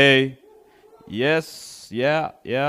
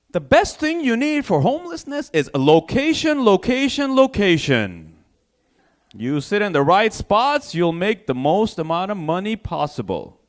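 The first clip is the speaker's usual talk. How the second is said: homeless guy as lecturer; 3 semitones higher than usual. Pretend. Homeless man teaching a survival course, lecturing. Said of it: "somebody give this guy a fucking".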